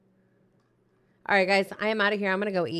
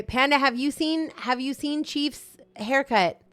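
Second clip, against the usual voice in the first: high, slow, drawn-out call; 7 semitones higher, 4 dB louder, slower than usual. Real, not acted. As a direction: sing out the question slowly. Calling out to Panda.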